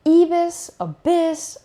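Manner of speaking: imitating his dad mispronouncing Avis as "abyss"; dad's accented English. Son imitates his dad's accented English, high. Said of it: "his English was like".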